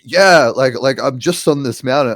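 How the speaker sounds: exasperated shouting at the phone, retold; retold yelling. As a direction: act out yelling a reply into voice dictation. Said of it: "I'm, like, yelling at my phone".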